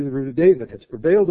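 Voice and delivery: usual speaking voice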